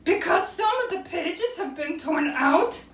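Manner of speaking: high, slow mock-weepy voice; crying Owl. A narrator's reading. Owl cries over sad things, high hammed-up lament. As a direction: lament it slowly as a storybook owl. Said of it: "Owl was crying".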